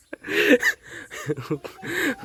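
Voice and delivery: a sudden high giggle he can't hold back; helpless laugh. Real, not acted. Vlogger laughing at his own fertilizer mix-up, real giggle. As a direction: laugh at your own blunder. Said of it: "ऊपर से हंसी आ रही है".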